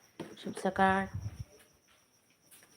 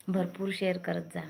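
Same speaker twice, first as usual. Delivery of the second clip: normal talking voice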